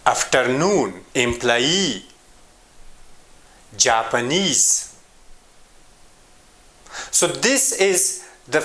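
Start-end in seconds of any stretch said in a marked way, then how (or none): 0.0-1.0 "afternoon" stressed on NOON; "afternoon" as af-ter-NOON, pitch peaking on the last syllable. Shown as a demonstration. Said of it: "At the end". 1.1-2.1 "employee" stressed on EE; "employee" as em-ploy-EE, pitch peaking at the end; stress on last syllable. Shown as a demonstration. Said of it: "At the end". 3.8-5.0 "Japanese" slowly, stressed on NESE; "Japanese" as Jap-a-NESE, a slow word demo; stress on last syllable. Shown as a demonstration. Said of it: "At the end".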